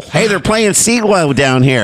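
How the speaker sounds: playful South Philly voice; Philly accent. Pretend. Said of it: "we do the Philly accent".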